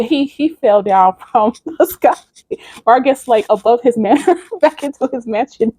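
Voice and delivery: can't help laughing as you tell it; laughing while talking. Real, not acted. Storyteller amused by the demon's fall, laughing. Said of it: "I shouldn't be laughing".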